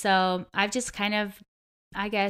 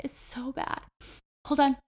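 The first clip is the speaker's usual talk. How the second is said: about to cry while she pulls herself together; choking up; 7 semitones higher, 8 dB louder than usual. Real, not acted. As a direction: voice catching, fighting back tears, then a gasp. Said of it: "I wanna cry thinking about it".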